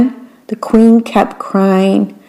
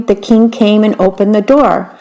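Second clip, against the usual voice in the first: ordinary delivery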